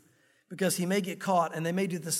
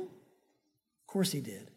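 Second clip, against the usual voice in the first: much lower, softer, slow; quiet certainty; 8 semitones lower, 7 dB quieter, much slower than usual. Real, not acted. Pastor answering his congregation, hushed and slow. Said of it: "answering his own question".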